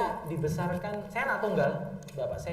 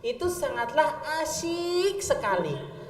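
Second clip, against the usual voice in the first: slow, higher, drawn-out reading; mock-moving reading of a child's essay over music; sentimental recital; 6 semitones higher, much slower than usual. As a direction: read it like a touching school essay.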